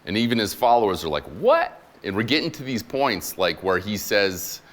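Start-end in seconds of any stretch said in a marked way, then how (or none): 1.2-1.8 high, loud incredulous "What?"; baffled what. Retold or imitated. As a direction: shoot up in disbelief. Followers baffled by Jesus.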